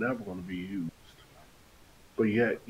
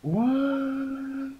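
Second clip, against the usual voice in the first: high, drawn-out "what" in disbelief; astonished; 13 semitones higher, 5 dB louder than usual. Real, not acted. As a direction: stretch it out, pitch soaring in amazement. Astonished at tuition refund claim.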